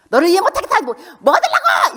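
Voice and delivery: high, loud exasperated scolding; exasperated nagging. Shown as a demonstration. Parent showing the wrong way to talk to a child, high and loud. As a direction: snap at the child in disbelief. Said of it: "이게 아닙니다".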